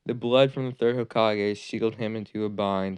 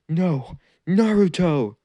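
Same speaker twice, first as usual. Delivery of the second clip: much higher, louder call after him; desperate shout; 8 semitones higher, 6 dB louder, slower than usual. Acted. Teacher shouts after the boy running away, desperate. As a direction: cry out for him to come back.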